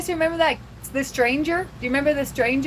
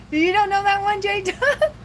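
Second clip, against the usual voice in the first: laughing question; 8 semitones higher than usual. Real, not acted. Laughs while asking chat.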